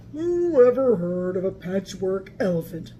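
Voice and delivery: lightly voiced Elmer thinking; lightly voiced sad Elmer. A narrator's reading. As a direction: Elmer wondering to himself. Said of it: "he thought".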